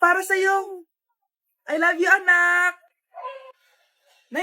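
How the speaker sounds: usual speaking voice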